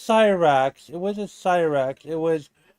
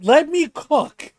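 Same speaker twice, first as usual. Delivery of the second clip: higher, slow, drawn-out boast; hyped let me cook; 8 semitones higher, 4 dB louder, slower than usual. Real, not acted. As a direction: savor it like you're about to show off. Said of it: "playful boast".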